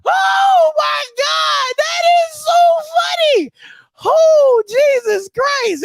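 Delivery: high, slow, laughing exclaim; can barely get the words out for laughing; laughing fit. Real, not acted. Cracking up at the promo, high and slow.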